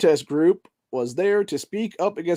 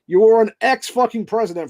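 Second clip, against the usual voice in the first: louder, higher indignant yell; indignant outburst; 5 semitones higher, 5 dB louder than usual. Real, not acted. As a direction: hurl it like an accusation. Ranting against ex-president pay.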